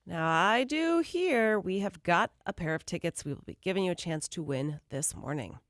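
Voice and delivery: normal talking voice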